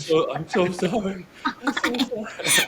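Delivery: high, slow, breaking into a whispered fake sob; hammed-up tearful apology to the TSA officer; mock crying. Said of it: "He's crying".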